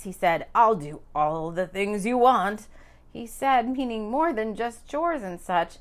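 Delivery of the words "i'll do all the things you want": suggestive promise. A narrator's reading.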